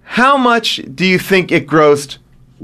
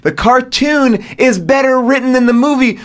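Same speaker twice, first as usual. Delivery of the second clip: ordinary talk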